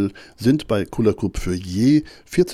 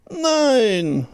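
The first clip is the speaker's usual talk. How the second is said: long, high-pitched cry of refusal; storybook cry; 12 semitones higher than usual. A narrator's reading. Hare refusing the winter, high drawn-out cry. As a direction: cry out high, stretching the word. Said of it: "schrie der Hase".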